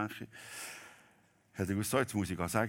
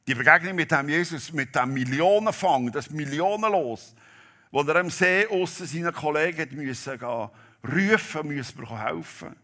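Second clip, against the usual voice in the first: loud, higher, fervent proclamation of the hymn lines; preaching at full force; 6 semitones higher, 9 dB louder than usual. Acted. Preacher to congregation, reciting the hymn in full-force preaching. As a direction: proclaim it to the whole church, swelling louder. Preacher retells the big catch.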